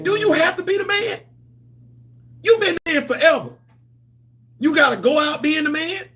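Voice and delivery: high-pitched incredulous questioning. Real, not acted. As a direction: ask it in disbelief, voice climbing high.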